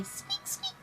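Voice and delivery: very high, tiny soft "Squeak"; tiny high mouse squeak for a baby. Shown as a demonstration. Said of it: "What does a mouse say?".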